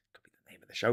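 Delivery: whispered joke; whispered aside. Real, not acted.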